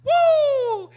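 high, loud sustained "woo"; long high holler of shock; loud whoop. Real, not acted. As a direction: let out a piercing whoop. Reaction to pastor's stinging reply.

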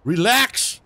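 high, tense and flustered; rattled, talking fast-paced back; nervous and excited. Real, not acted. Stressed by NPC pleading.

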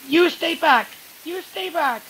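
high, loud repeated shout; Alice shouting at Edward with the knife, loud and high; shouted warning. Acted. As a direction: yell it to keep him away.

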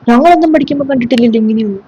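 ordinary talk, nothing marked

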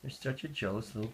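usual speaking voice

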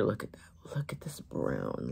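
breathy whisper over the swatch; awed whisper to the viewers. Real, not acted. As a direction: hush it like a secret admiring the colour. Whispering over the gloss colour.

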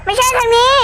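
panicked. Real, not acted. Panicking in horror game.

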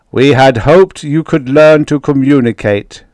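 booming alien address; roaring voice. A narrator's reading. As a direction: roar it as a pronouncement from the ship. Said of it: "roared the voice".

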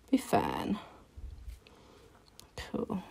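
a quick whispered aside to herself. Real, not acted. Whispering to herself after a slightly long line, calmly. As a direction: breathe it under your breath, unbothered.

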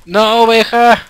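high, loud pleading cries; panicked shout. Real, not acted. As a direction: yell in shock at the sheep. Sheep catches fire, panics. (grito desesperado a la oveja)

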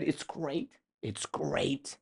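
real choked-up voice, high and soft. Real, not acted. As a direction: push the words through a tightening throat. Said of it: "I didn't choke up at the time".

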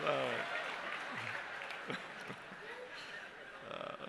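high, breathy fits of laughter; can't stop laughing; real laughter after the punchline; helpless laughter. Real, not acted. Teller laughs at own joke.

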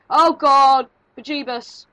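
very loud, high yelp of fright; startled shout. Real, not acted. Player hit by a jump scare, startled shout.

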